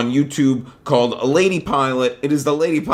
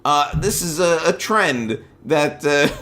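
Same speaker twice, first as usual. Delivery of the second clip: ordinary talk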